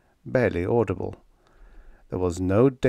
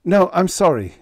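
high, loud, hurried sorry; urgent backpedalling; quick apology; 10 semitones higher, 7 dB louder than usual. A narrator's reading. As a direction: rush to placate him. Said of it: "Kirk said quickly".

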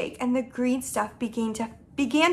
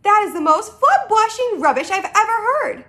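high, loud scolding; heated, pitched-up protest; indignant cry; 8 semitones higher, 5 dB louder than usual. Acted. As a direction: scoff with rising outrage. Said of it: "cried the B F G".

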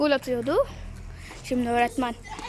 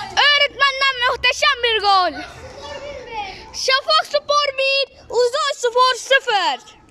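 screaming football commentator; 8 semitones higher, slower than usual. Acted. Kid doing live play-by-play.

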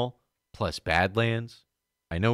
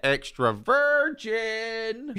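very slow, stretched high voice; drawn-out mock announcer; 13 semitones higher, much slower than usual.

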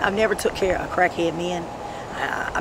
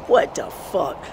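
usual speaking voice

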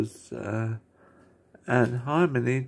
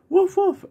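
very high, loud mock barks; dog barking; 6 dB louder than usual. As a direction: bark like a little cartoon dog. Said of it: "[name] going".

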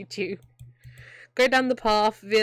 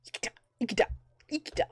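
whisper; 6 semitones higher, 6 dB quieter, much faster than usual. Real, not acted. Muttering the name to herself.

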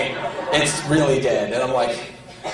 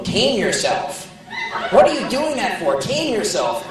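mock scolding fans; 8 semitones higher than usual. Voicing scolding record buyers.

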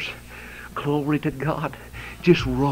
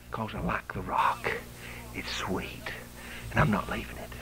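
hushed; 3 semitones lower, 7 dB quieter than usual. Real, not acted. Hushed tender turn after shouting.

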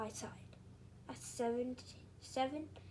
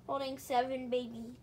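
ordinary delivery

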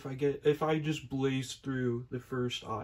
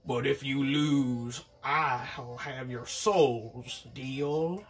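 menacing devil; much slower than usual. Acted. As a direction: threaten them slowly like the devil. Devil voiced in story.

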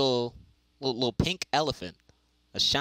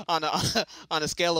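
laughing; 6 semitones higher, faster than usual. Real, not acted. Laughing after botched joke.

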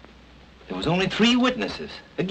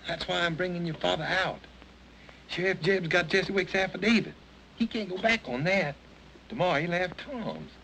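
softer, hushed urging; soft pleading; 7 dB quieter than usual. Acted. Ben quietly pleading with Julie through the door.

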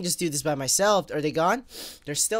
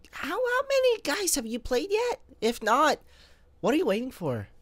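high squeaky voice; 10 semitones higher than usual. Pretend. Asking viewers in squeaky voice.

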